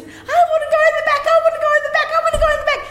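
put-on high fast pleading little girl voice; child's whine. Little sister begging to ride in the back, high childish whine. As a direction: whine it over and over like a stubborn seven-year-old.

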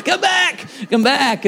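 high, loud call to a passing car; waving down friends, loud high call; calling after them. Retold or imitated. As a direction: yell to bring them back. Calling friends driving past.

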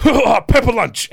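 very high, very loud, slow exclamation; imitated delighted outburst. Imitating the referee seizing on the name, loudly excited. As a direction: belt it out high, drawing out the words. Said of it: "And he just went".

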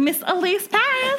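high, drawn-out fan call; mock fangirl. Friend playing fans who stopped her, mock fangirl. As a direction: slowly coo her name like a fan.

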